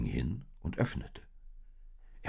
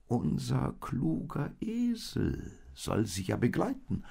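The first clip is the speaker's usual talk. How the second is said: higher sheep voice, calming; calming old sheep; 9 semitones higher than usual. Acted. Oldest sheep calms the youngest lamb, higher. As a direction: soothe the worried lamb. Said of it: "antwortete das älteste Schaf".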